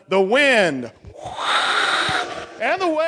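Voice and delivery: rising in pitch into the waves; dramatic storm build. Acted. Telling children the storm story, dramatic.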